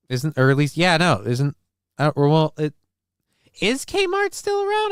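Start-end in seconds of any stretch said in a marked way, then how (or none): 3.6-4.9 voice crack. Real, not acted. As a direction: voice cracks high on the question. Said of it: "Thank you, Voice Crack".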